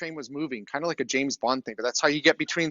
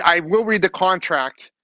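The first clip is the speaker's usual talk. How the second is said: slow, louder, every word pressed; firm insistence; 8 dB louder, slower than usual. Retold or imitated. Customer insists to the shady clerk on reading the contract, slow and louder. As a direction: insist slowly, not taking no. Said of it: "Pretty shady".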